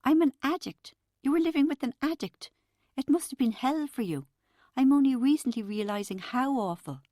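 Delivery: high, strained voice near tears; holding back tears. A narrator's reading. Narrator near tears, comforting her crying friend. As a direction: fight back crying on Don't. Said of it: "I was trying not to cry".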